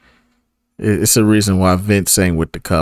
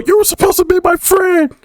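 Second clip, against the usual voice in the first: much higher, strained falsetto wail of betrayal; mock Batista yell. Imitating Batista wailing at Rey in the ring. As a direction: shriek it like a betrayed wrestler. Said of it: "and then go".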